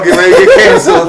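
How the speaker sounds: fast, high laughing tease. Real, not acted. Teasing, laughing.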